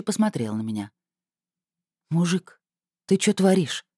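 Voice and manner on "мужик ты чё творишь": ordinary delivery, nothing marked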